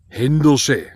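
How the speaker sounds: "Händersche" said in broad Swabian; Swabian word. Shown as a demonstration. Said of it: "sagen wir im Schwäbischen". (schwäbisch: „Händersche")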